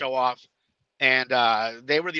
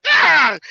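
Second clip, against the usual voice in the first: high, loud yelp of panic; hammed-up cry of alarm; mock scream; 11 semitones higher, 12 dB louder than usual. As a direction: yelp as you're tossed. Retelling being thrown around.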